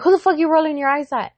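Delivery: fast, high-pitched challenge; indignant mom. Retold or imitated. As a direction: confront the eye-roller in mock outrage. Mother challenging eye-rolling, retold.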